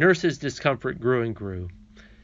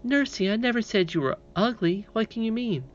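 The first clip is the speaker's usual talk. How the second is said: little princess voice; 9 semitones higher than usual. Acted.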